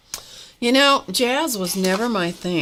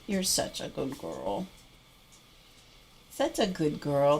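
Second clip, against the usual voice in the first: soft praise; 6 dB quieter than usual. Real, not acted. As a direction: murmur softly to the pet. Praising dog softly.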